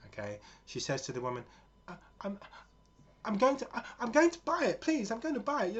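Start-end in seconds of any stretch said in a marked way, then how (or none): 1.9-5.8 high, loud desperate plea; pleading. Retold or imitated. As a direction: beg to be allowed to buy. Daughter pleads with the woman.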